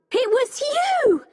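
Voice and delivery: high-pitched accusing cry; shouted accusation. A narrator's reading. Becky to the old man, shouting an accusation. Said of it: "started shouting".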